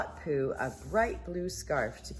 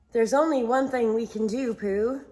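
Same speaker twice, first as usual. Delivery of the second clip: put-on declaring boy voice; 6 semitones higher, 7 dB louder than usual. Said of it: "Christopher Robin declared".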